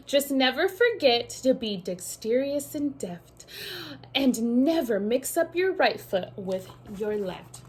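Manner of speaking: lively picture-book read-aloud; sing-song storybook reading; reading to children. A narrator's reading.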